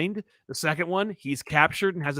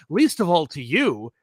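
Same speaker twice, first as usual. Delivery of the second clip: slow, pointed brush-off; mildly haughty refusal to explain; dismissive retort; 3 dB louder, slower than usual. Retold or imitated. Professor X brushing off Wolverine's criticism, slow and dismissive. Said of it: "And he's like, "I don't have to explain myself".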